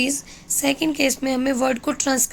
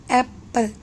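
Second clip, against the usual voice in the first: "apple" in two separate beats, ap - ple; split into beats. Shown as a demonstration. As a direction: pause between the syllables of "apple". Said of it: "you can hear these beats".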